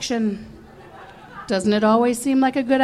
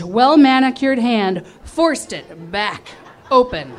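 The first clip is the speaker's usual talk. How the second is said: plain reading voice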